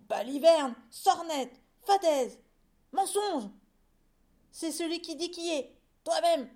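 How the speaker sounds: loud, high volley of insults; quarrelsome shouting. Acted. Two rival farmers trading insults, quarrelsome shouts. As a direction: fire off each word separately.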